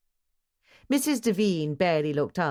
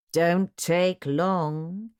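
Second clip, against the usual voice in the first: slow, drawn-out warning from a lightly voiced man; 2 semitones higher, 3 dB louder, much slower than usual. A narrator's reading. Mister Sloop warning the maid not to dawdle, slowly. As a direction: stretch it out as a veiled threat. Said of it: "he warned".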